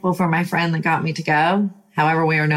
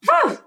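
fired-up whew. Real, not acted. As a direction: let out a whoop, all fired up. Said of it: "I just get fired up".

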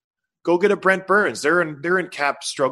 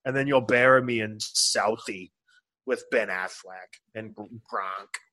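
mock Boston accent; 3 semitones lower, slower than usual. Host riffs on lobster in Boston accent. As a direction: drag it out like a Southie local.

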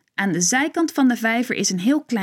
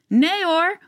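high, lively call across the ice; 8 semitones higher than usual. A narrator's reading. Ping calls to Joep on the ice, high call. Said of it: "roept Ping".

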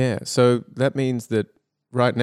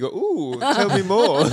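a hammed-up "ooh", pitched higher and louder; teasing co-host with mock interest, higher and louder; mock intrigue; 9 semitones higher, 5 dB louder, much slower than usual. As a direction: play up fake curiosity to coax more out. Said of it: "it was a look of intrigue".

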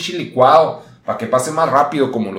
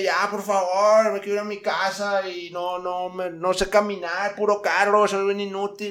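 put-on higher, softer, begging old woman; mock tearful señora, understated; pleading old lady; 6 semitones higher, 5 dB quieter than usual. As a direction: beg to go home, fading away. Old lady pleading, going soft.

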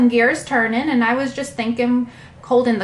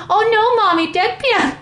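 high, loud child's cry, mock; imitating a toddler's yell; 10 semitones higher, 4 dB louder than usual. Mother quoting her toddler yelling about the pan. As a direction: play her little son wailing over the pan. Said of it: "he yelled".

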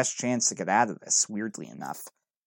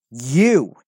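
angry shout; 8 dB louder than usual. A narrator's reading. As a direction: spit the word out in sudden rage. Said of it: "then turn to anger".

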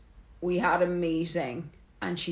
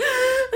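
high mock crying gasp; imitated sobbing. Retelling crying at work, imitated sobbing. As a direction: act out an uncontrollable sob. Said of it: "I was there crying uncontrollably".

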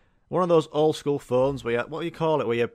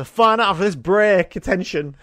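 much higher, louder booming sign-off; mock host announcement; 7 semitones higher, 10 dB louder than usual. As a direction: cut to the break like a hammy TV host. Imitating host cutting to ads.

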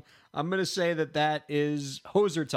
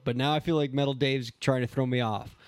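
ordinary talk, nothing marked

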